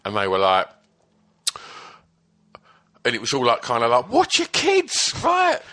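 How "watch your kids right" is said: very high, slow, stretched-out TV call; kids' TV presenter. Retold or imitated. Presenter on kids' breakfast show, exaggerated high hype. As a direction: parody a hyped-up children's show host.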